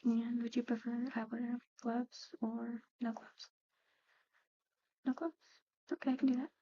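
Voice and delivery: ordinary talk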